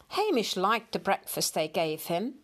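usual speaking voice

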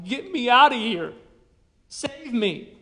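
loud, high yell for rescue; imagined desperate yell. Retold or imitated. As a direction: cry out as if trapped and begging to be saved. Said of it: "It was almost as if she was screaming, yelling".

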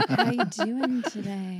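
Team Babe impression. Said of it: "Team Babe impression".

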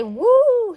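loud, high-pitched "woo"; high whoop. A narrator's reading. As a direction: whoop it out like on a coaster. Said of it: "He didn't even say".

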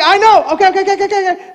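mock flustered placating; flustered reassurance. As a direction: imitate a panicking grandpa trying to soothe. Flustered grandpa reacting to child's distress.

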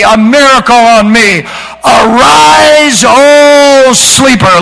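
high, loud, slow preacher's cry; full-force preacher; preaching at full force. Acted. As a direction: build to a ringing altar-call climax. Preaching climax.

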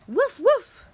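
high, loud pair of woofs; playful dog bark. Shown as a demonstration. Showing kids how to woof, high bark. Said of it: "Give me a woof".

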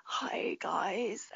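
ordinary delivery

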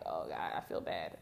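low, quiet, pained groan; retold chest pain, low and quiet.

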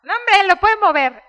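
imitating a dad who shrugs it off; mock dismissive dad.

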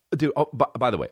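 ordinary talk, nothing marked

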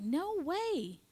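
high, incredulous exclamation; mock disbelief. Talking back to a stray thought, incredulous. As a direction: swoop up high in playful disbelief.

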